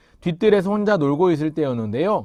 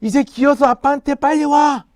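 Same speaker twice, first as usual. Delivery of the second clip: urgent shout; 11 semitones higher, 8 dB louder than usual. Acted. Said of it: "다시 아빠가 외쳤어요".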